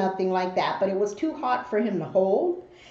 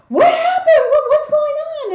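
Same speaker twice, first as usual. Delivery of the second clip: loud, very high alarmed questions; acted high-pitched panic; imitating panicked cousin; 8 dB louder than usual. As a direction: burst in shrieking, demanding to know what happened. Voices panicked cousin bursting in.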